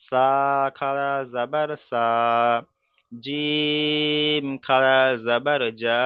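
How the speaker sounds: very slow, level chant, each letter name and final vowel held long; drawn-out recitation. Shown as a demonstration. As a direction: stretch বা, তা, সা one alif each. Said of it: "তাহলে উচ্চারণটা কেমন করে করব".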